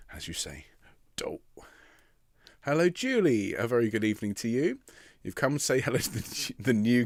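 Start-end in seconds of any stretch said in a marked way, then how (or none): 0.1-1.4 whispered aside. Acted. Playful aside.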